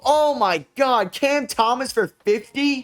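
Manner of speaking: high, strained whine of disbelief; high-pitched exasperated wail; frustrated whine. Real, not acted. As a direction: wail it like you can't believe the collapse. Losing to the Nets, frustrated.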